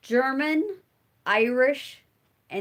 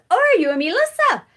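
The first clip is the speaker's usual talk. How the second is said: delighted poet; 9 semitones higher, 7 dB louder, faster than usual. Retold or imitated. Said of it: "And she says".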